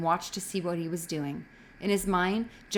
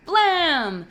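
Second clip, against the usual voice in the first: mock gunshot; 9 semitones higher, 8 dB louder than usual. A narrator's reading. Boy mimics a gunshot.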